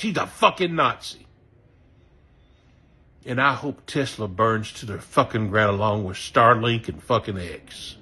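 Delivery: ordinary talk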